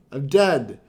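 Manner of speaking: ordinary talk, nothing marked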